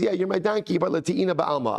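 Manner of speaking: defensive Bilam. Acted. Bilam answering his indignant donkey, defensive. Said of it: "Bilam says".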